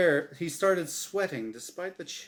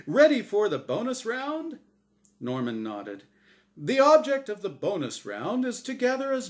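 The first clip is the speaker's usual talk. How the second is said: game show announcer; 8 semitones higher, 5 dB louder than usual. Acted. Azrael hyping Norm's win to the crowd, big announcer voice. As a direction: boom it out like a TV prize reveal.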